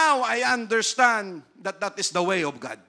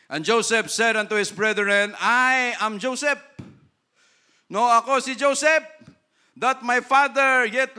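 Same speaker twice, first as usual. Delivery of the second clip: scripture reading; 4 semitones higher, 6 dB louder than usual. Acted. Pastor reading Genesis aloud to the church, in a solemn, ringing voice. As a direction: proclaim the verse to the congregation.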